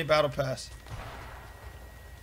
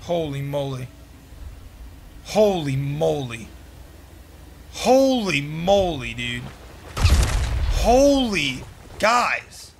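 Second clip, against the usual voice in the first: amazed; 3 dB louder, slower than usual. Real, not acted. Amazed, building louder.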